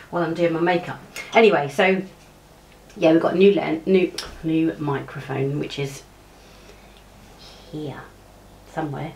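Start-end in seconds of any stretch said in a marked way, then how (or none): 5.6-9.1 quiet mumble. Real, not acted. Searching, trailing off, very quiet.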